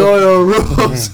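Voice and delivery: loud high chant; yelling. Retold or imitated. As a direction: shout it like a bully. Yelled again, laughing.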